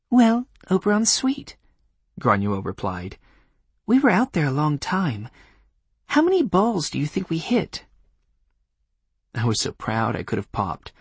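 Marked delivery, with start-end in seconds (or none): 0.1-1.6 put-on young woman voice. Voicing Granuaile. 3.9-7.8 put-on young woman voice. Voicing Granuaile.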